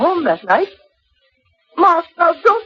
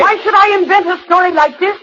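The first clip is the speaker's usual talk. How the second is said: raised, hurt protest; higher and louder, wounded and defiant; indignant protest; 7 semitones higher, 4 dB louder than usual. Acted. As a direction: fire back at the accusation. Daughter accused, protests loudly.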